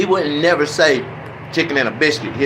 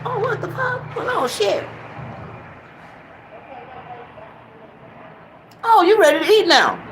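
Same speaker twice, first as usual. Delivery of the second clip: shocked. Retold or imitated. Startled reply to cursing parrot.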